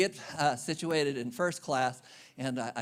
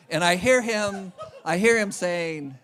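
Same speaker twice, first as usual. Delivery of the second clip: ordinary talk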